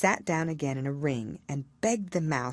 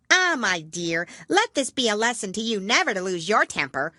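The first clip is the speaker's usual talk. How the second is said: higher, louder preachy matron; mother crab moralising to her child; old crab mother; 6 semitones higher, 6 dB louder than usual. A narrator's reading. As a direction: lecture your daughter. Old crab lecturing daughter.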